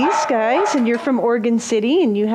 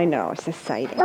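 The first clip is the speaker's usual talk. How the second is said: talking to a dog; 10 semitones lower, 8 dB quieter, faster than usual. Real, not acted. Soothing the excited dog.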